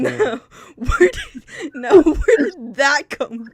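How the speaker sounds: slow words through laughter; laughing while talking. Real, not acted.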